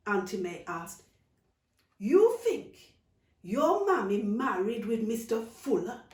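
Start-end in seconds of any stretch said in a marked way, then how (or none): 2.0-6.0 incredulous aunt. Acted.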